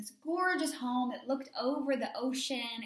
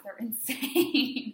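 laughing whisper; 7 dB louder, much slower than usual. Real, not acted. Joking about crazy parents.

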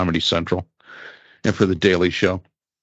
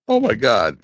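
incredulous reaction; 3 semitones higher than usual. Retold or imitated. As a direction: gasp out your disbelief at the answer. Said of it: "I'm sitting there going".

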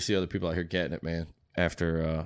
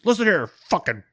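higher, louder barking sergeant major; 11 semitones higher, 8 dB louder than usual. Retold or imitated. Sergeant major insulting a departing soldier, barking loud. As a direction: bark it down at the recruit like a drill sergeant. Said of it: "or he goes".

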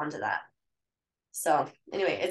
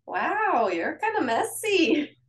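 higher, slow and dripping with sarcasm; sarcastic tone; 9 semitones higher, 3 dB louder, much slower than usual. Retold or imitated. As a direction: needle your husband with mock surprise. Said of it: "it was totally a sarcastic tone".

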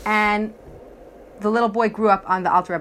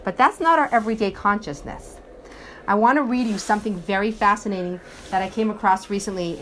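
ordinary talk, nothing marked

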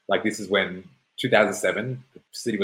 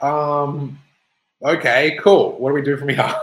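high, loud, awkwardly eager; nervous compliance; 6 semitones higher, 6 dB louder than usual. Retold or imitated. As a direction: act out a rattled kid playing along. Nervous teen answering the cop.

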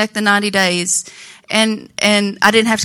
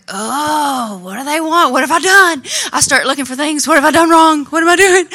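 higher, anxious retold worry; nervous panic; 5 semitones higher than usual. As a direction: act out the jittery dread at work. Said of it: "I turn into a ball of nerves".

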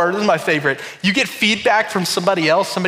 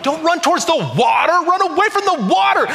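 high, louder yell at the screen; mock yelling; 12 semitones higher, 4 dB louder than usual. Pastor yelling at Titanic actors, high mock shout. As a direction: shout at the TV like a fan. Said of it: "I am just yelling".

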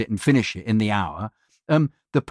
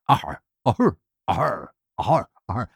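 pirate aha takes. Retold or imitated. Actor redoing his pirate aha for the client. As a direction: run of pirate ahas, each a shade different. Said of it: "thirty-five takes of me going".

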